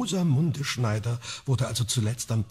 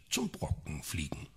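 hushed, spooky storyteller whisper; conspiratorial whisper; 8 semitones lower, 11 dB quieter than usual. Acted. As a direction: whisper the witch lore like a secret. Telling spooky witch lore.

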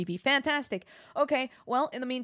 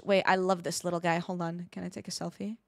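normal talking voice

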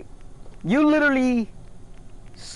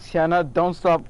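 usual speaking voice

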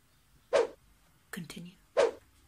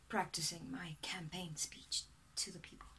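sheepish whisper; 4 semitones lower, 8 dB quieter than usual. Real, not acted. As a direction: whisper it, embarrassed. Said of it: "Sorry, I was".

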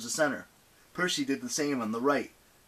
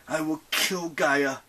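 slow, higher-pitched vow; angry mutter; 5 semitones higher, 3 dB louder, slower than usual. A narrator's reading. Percy vows revenge on Gaia, muttering angrily. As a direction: mutter it slowly with some anger and force. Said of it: "he muttered".

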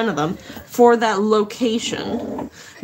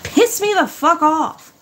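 ordinary talk

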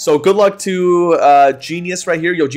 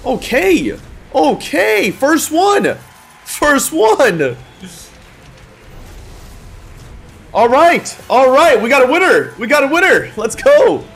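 excited celebrating; 7 semitones higher, slower than usual. Real, not acted. Winner celebration.

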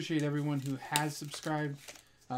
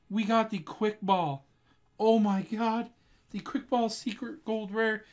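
raised pitch, thrilled and emphatic; freaking out happily; 4 semitones higher than usual. Real, not acted. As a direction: gush with joy over the best pull yet. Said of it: "I'm freaking out over here".

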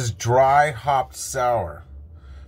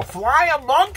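excited; 10 semitones higher, 4 dB louder than usual. Real, not acted. Excited exclamation.

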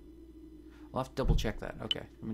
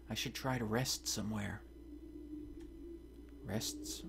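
normal talking voice

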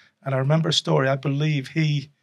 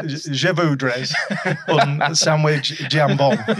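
slow, hammed-up Northern French accent; 6 dB louder, much slower than usual. Guest mocking his own French to the host, in a Northern French accent. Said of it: "I try to put my best Northern French accent on".

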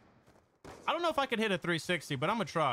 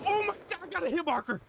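excited high squeal; 9 semitones higher, faster than usual. Real, not acted. Streamer to chat after landing a hit, a high excited squeal. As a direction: voice jumps up high in a thrilled squeal. Lands a hit mid-game.

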